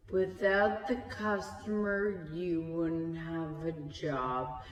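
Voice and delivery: very slow, deliberate imitation; mock condescension. Bystander lecturing the employee about customers, slow mock condescension. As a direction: stretch each word like a lofty put-down.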